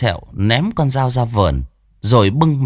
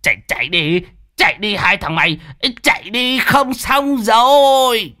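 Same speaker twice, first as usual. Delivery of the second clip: yelling at two friends to run for their lives; panicked cry; 12 semitones higher than usual. Acted. Old man screaming at his friends to flee, panicked.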